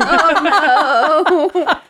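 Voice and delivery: loud, high laughing oh no; laughing groan. Real, not acted. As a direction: groan through a big laugh. Reacting to skunk hat.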